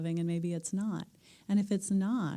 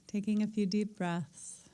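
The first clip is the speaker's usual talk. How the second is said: calm meditation teacher settling the room; guided meditation. Acted. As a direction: ease the group gently into stillness. Guides breathing and listening.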